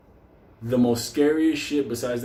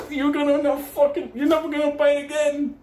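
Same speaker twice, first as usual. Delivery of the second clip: mock threat; 12 semitones higher than usual. Friend cursing the coyote.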